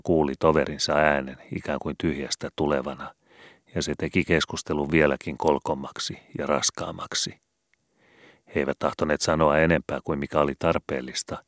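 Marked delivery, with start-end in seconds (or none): none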